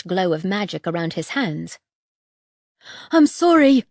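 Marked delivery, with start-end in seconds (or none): 3.1-3.8 high, loud frightened gasp; gasped apology. A narrator's reading. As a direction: blurt it out in panic. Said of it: "gasped the boy".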